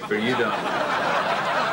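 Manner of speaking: slow, smooth charmer; suave and slow. Acted. Slow suave line to woman.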